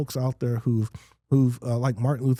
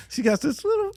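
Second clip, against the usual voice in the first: much higher, imitating a little girl's voice. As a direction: squeak it like a little girl. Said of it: "she has a high-pitched voice".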